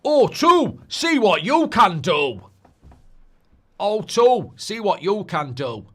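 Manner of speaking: loud, punchy Northern advert voiceover; Sean Bean. Acted. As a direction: belt out the slogan like a TV advert. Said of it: "I can do Sean Bean".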